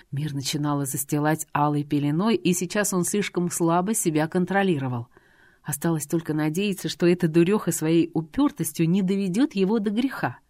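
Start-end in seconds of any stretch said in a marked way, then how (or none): none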